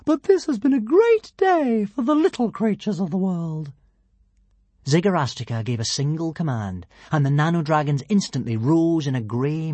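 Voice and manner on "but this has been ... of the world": high, proud little dragon; tiny dragon voice. A narrator's reading. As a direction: speak up like a tiny proud ruler.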